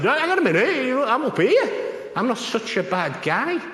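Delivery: high, loud, offended inmate pushing back; indignant protest. Retold or imitated. Inmate protests to the chaplain, loud indignant voice. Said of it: "And the other lad said".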